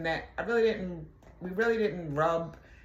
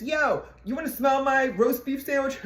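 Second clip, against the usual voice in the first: high-pitched mocking call; mock holler, pitched well up; retold yell; 9 semitones higher, 4 dB louder than usual. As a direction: imitate a coworker hollering out a taunt. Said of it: "he yelled".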